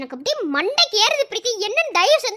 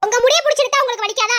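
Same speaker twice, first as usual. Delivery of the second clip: louder, faster, indignant retort; angry retort; 3 semitones higher, 4 dB louder, faster than usual. Acted. Wife snapping at husband who scolded the child, angry. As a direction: fire it back at him, offended.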